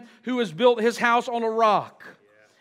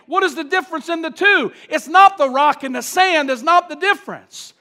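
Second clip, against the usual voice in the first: loud, raised-pitch preacher's push; forceful preaching; 5 semitones higher, 9 dB louder than usual. Real, not acted. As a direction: drive the contrast home to the congregation.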